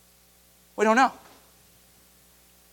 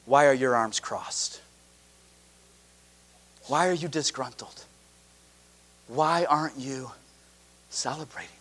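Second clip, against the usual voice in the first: hushed, low and tender, arms open; soft pleading Jesus; 11 semitones lower, 8 dB quieter than usual. Acted. Preacher voicing Jesus to the elder son, hushed and tender. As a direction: plead gently, almost whispered.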